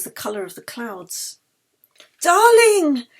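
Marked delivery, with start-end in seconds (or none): 2.2-3.1 alarmed cry. A narrator's reading. Friend collapses, Yeli cries out.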